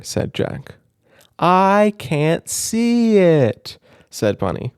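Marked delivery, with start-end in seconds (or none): 1.4-3.8 high, loud, very slow moan; loud complaint. Acted. Bunny pretending loudly she can't see, slow and loud. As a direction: stretch it out loudly for show. Loud staged exchange continues.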